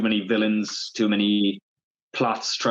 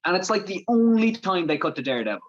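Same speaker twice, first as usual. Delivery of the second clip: ordinary talk, nothing marked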